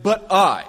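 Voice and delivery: "but I" with "I" hit high and loud; stress on I. Shown as a demonstration. Preacher giving God's reply, loud stressed "I". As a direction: land hard on "I" as God's answer. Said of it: "this explicit pronoun twice there".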